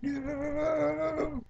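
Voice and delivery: long, very high-pitched wail; thin drawn-out fake shriek; mock scream. Girl's terrified cry, acted.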